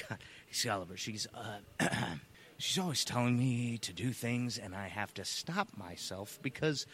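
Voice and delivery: low, soft, secretive clown; hushed confiding. Acted. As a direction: confide it quietly, as if the stick might hear. Clown confides about his talking stick.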